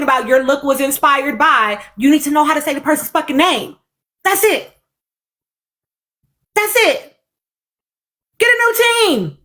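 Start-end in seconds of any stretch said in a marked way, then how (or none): 4.2-7.1 exasperated. Real, not acted. Exasperated repeat. 8.4-9.4 fed-up. Real, not acted. Fed-up demand.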